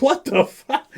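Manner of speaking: high, stunned mock disbelief; shocked reaction. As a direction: squeal it like you can't believe what you just saw. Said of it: "And I'm like".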